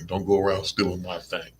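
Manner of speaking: usual speaking voice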